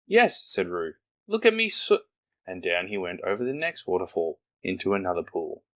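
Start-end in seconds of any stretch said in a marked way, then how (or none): 0.1-2.0 much higher squeak of a little kangaroo; high, proud squeaky little Roo. Acted. Roo's voice in story.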